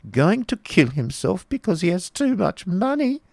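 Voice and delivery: high-pitched, amused disbelief; tickled exclamation. A narrator's reading. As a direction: squeal it as the delighted Baron. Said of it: "exclaimed the Baron, quite tickled".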